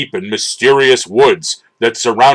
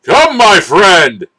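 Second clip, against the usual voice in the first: slow, high, loud call; heroic shout; 6 semitones higher, 3 dB louder, slower than usual. A narrator's reading. Hero calling his cat to battle, slow and loud. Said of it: "shouted He-Man".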